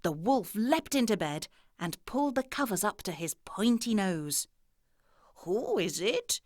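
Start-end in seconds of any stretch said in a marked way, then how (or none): 5.4-6.4 sweetest voice. A narrator's reading. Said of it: "he said in the sweetest voice he could manage".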